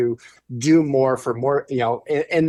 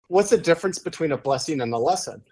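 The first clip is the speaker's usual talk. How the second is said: ordinary talk, nothing marked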